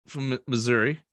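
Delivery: ordinary talk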